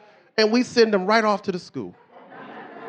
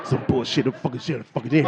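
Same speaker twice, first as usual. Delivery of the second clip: low, grumbled mock cussing; cussing stepdad; 11 semitones lower, 4 dB quieter than usual. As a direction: imitate a stepdad swearing under his breath.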